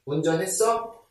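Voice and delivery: ordinary talk, nothing marked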